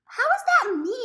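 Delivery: high, loud disbelief; incredulous protest. Real, not acted. Rejecting his blame for the mukbang, incredulous. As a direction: shoot up in pitch, indignant.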